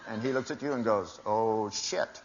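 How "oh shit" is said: oh shit voice. Retold or imitated. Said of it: "You know the "oh, shit" voice".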